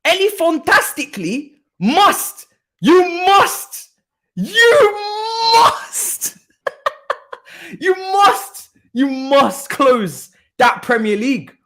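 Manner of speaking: high, loud, drawn-out mock plea; mock pleading. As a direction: beg them theatrically, stretching the words. Mock-dramatic pleading chant at rival club.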